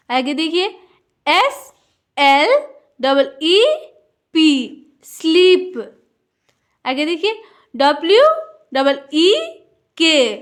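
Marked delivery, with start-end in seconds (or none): 5.1-5.8 स्लीप with a drawn-out ee; long ee. Shown as a demonstration. Teacher reading a double E word, stretched स्लीप. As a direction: hold the vowel in स्लीप before the p. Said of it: "थोड़ा लंबा होगा".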